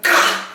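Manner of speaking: loud strangled gah of pain; sudden pained yelp; unhuman cry. Retold or imitated. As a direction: yelp as the leg is twisted. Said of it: "I made the most unhuman sound".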